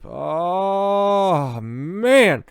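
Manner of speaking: high, loud disbelieving groan; cringing oh man. Real, not acted. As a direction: recoil at how bad it is. Cringing at the quoted message.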